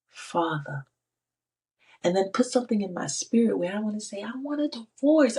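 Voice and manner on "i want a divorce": high, sharp outcry; mock yell. Wife telling God her old marriage is over, a high yell. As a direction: blurt it out like a yell. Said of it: "I literally just yelled it like that".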